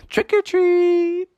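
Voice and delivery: put-on high, drawn-out kid chorus; trick-or-treat call. Kids at the first door, calling trick-or-treat. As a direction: sing it out slowly and high.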